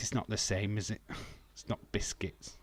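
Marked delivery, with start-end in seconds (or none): 1.5-2.6 hushed under-breath remark; whispered aside. Real, not acted. Streamer whispering about Southern biscuits. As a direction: mutter it to yourself. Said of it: "quiet aside".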